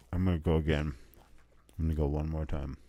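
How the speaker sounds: talking through a mouthful; low words round a bite; mouth full. Real, not acted. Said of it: "my mouth is so full".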